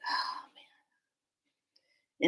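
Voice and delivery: soft, breathy whisper; acted whispered awe. Newcomer hears of past lifetimes, acts whispered awe. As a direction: breathe it out, overwhelmed. Retold overwhelmed reaction to cacique.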